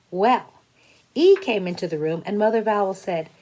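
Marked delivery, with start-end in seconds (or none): none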